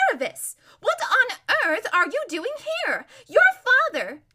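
high, loud astonished outburst; shrieky surprise, cut off mid-sentence; top of her voice. Acted. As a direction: squeal it out for everyone to hear. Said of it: "burst out at the top of her voice".

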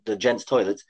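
usual speaking voice

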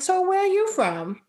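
high-pitched, rising mock inquiry; nosy questioner. Imitating people probing her origins, mock-curious. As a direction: imitate someone fishing for an answer.

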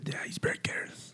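breathy sultry whisper; sultry narrator. Acted. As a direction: whisper it seductively, slowly. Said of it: "Esa voz sensual".

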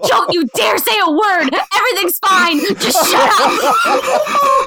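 acted-out scolding rising to a drawn-out shout; mock frantic warning. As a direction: build to a high "shut up" with a laugh. Retold panicked warning to waiter.

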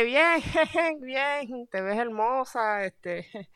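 high, nervous laugh-filled answer; nervous reply. Retold or imitated. Scared boyfriend answering the clown mother, nervous and high. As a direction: stammer it out uneasy. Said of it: "Y yo como que".

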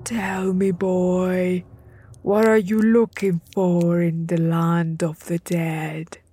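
put-on loom over the boy with a dread voice; menacing god of the dead; terrifying voice. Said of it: "a terrifying voice that said".

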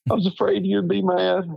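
high, mock crying voice; imitating a tearful wife. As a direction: whimper it out as the wife, half in jest. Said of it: "give me the whole crying".

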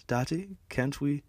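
put-on juvenile kid voice. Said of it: "as juvenile as".

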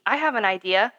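ordinary delivery

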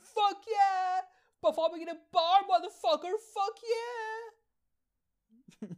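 high, loud triumphant yell; screaming with joy. Retold or imitated. On his bike, celebrating the bar gig, joyful scream. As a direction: scream it out like you just won. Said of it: "I was fucking screaming".

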